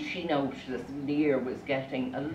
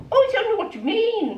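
very high, quick, louder denial; feigned innocence; 5 dB louder, faster than usual. Acted. Wife denies everything to fuming husband, high mock innocence. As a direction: protest innocence in a squeaky voice.

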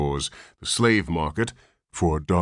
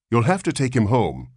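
loud firm insistence; insistent nurse; 7 semitones higher, 5 dB louder than usual. A narrator's reading. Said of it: "insisted the nurse".

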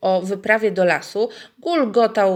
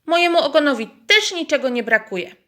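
higher, huffy and offended; mildly indignant hen voice; 8 semitones higher, 7 dB louder, faster than usual. Acted. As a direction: defend her tail, insulted. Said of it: "oburzyła się gdaczka".

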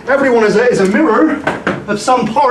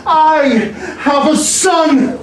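higher, a happy burst; joyful cry; 5 semitones higher, slower than usual. Acted. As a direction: cry it out with sudden joy. Joyful realization.

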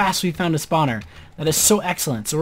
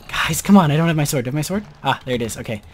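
ordinary delivery

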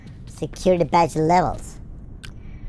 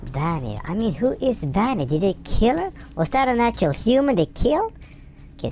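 normal talking voice

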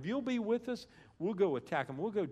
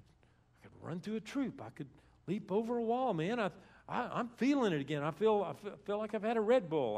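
higher, excited voice building louder; fired up; 5 semitones higher, 4 dB louder than usual. Retold or imitated. Preacher voicing David feeling his strength return, fired up. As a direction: get pumped up, ready to take on anything. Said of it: "hear how fired up he is".

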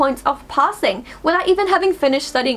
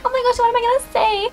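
high, fast flustered inner voice; mock panic; 10 semitones higher, faster than usual. Student panicking over a speech, high flustered voice.

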